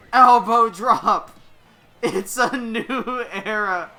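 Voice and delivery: lively ringside play-by-play; wrestling commentator. Acted. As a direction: call each move as it lands.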